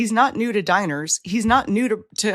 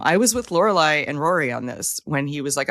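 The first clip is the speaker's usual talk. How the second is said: ordinary delivery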